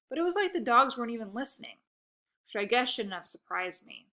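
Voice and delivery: ordinary delivery, nothing marked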